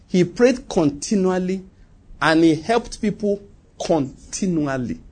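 slow phrases, each landing on a drawn-out "continually"; pause, then stretch "continually" as the key word; stress on continually. Real, not acted. Preacher listing Cornelius's two habits, slowly emphasizing "continually". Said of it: "I want to emphasize that word".

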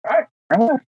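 quoting the examiner's crisp instruction; examiner's order. Retold or imitated. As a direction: play the driving examiner calling the turn. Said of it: "He's like".

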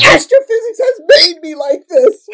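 loud, laughing admission. Real, not acted. Explaining her bluntness to the host, laughing. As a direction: confess it while cracking up.

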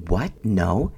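disbelieving question with a rising swoop; taken-aback questioning; incredulous echo. Retold or imitated. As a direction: echo it back as if you can't believe it. Echoing husband's answer in disbelief.

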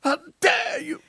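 high, shouted mock Greta Thunberg; Greta rant. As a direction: screech it like an outraged teen activist. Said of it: "Greta impression, mocking".

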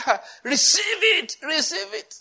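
hammed-up pastor handing out a miracle; mock pastor. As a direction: parody of a pastor intoning receive it. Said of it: "I say".

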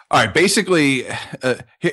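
ordinary talk

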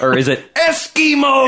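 high, loud Texan cowboy voice; Texas accent. Retold or imitated. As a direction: shout it like a brash Texan. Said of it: "terrible Texas accent".

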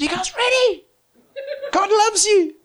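put-on very high, excited call to children; gleeful high-pitched announcement; rousing kids. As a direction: hype up a group of little kids.

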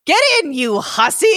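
gleeful rude goblin bark; yelled insult. A narrator's reading. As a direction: snap it at the bride like a nasty old goblin. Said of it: "he yelled".

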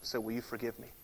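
soft, humbled asking; humble request. Shown as a demonstration. Preacher modeling how to ask forgiveness, humble and soft. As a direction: ask it quietly, with humility. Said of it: "I've gotta say".

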